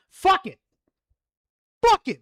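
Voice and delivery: high, loud exasperated shout; giving up with a loud curse; frustrated outburst. Real, not acted. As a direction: blurt it out in real frustration. Frustrated at forgetting the title.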